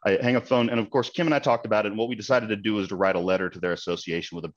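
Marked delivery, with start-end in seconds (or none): none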